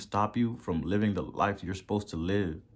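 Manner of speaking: ordinary delivery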